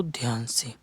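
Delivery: whispered aside to the class. Real, not acted. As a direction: whisper it, drawing them close. Teacher urges attention. (फुसफुसाकर)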